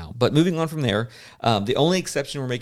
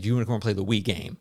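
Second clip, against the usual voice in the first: ordinary talk, nothing marked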